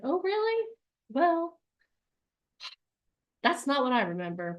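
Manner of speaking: the elephant mocking the logical monkey's plan, sarcastic; sarcastic doubt. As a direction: scoff at the plan.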